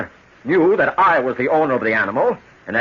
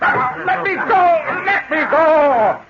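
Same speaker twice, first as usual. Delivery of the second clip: much higher, slow desperate cry to be freed; panicked struggle; much slower than usual. Acted. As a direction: fight against the hands holding you. Captured sailor struggling.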